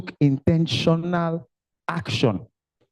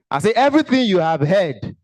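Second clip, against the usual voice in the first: louder emphatic repeat; 5 semitones higher, 6 dB louder than usual. Real, not acted.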